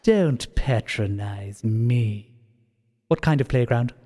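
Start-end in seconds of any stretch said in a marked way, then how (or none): 0.0-2.3 very slow, low and cold; cold warning. Acted. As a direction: warn them slowly and coldly. Villain warns coldly.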